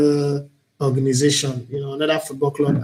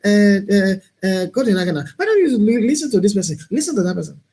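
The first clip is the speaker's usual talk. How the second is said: lightly mocking, much higher nagging voice; nagging advisers; 6 semitones higher than usual. A host mocks pushy advisers with a higher, nagging voice. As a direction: mimic the busybodies telling him how to talk. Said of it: "everybody have a way, you know".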